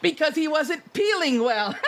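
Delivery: a giddy creature delivering its punchline, laughing; gleeful joke teller. Acted. Character tells a pun.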